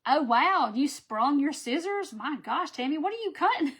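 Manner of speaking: high, loud amazed reaction; a loud, wide-eyed gasp of disbelief; surprised. Real, not acted. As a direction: react with astonishment. Reacting to viewer's sprung scissors.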